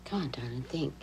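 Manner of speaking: soft coaxing whisper. Real, not acted. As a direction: whisper the endearment, no voice. Gently urging a small child.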